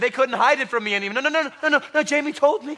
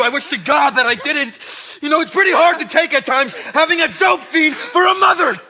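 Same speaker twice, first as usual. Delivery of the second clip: angry outburst; 4 semitones higher, 3 dB louder than usual. Acted. Son lashes out at mother.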